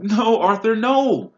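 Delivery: high, loud shriek. A narrator's reading. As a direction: scream to stop your husband. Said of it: "Missus Weasley was shrieking".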